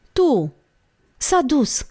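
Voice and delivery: high, understated frightened cry; stifled scream. A narrator's reading. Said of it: "țipă Olguța, înnădușită". (țipăt înăbușit, reținut)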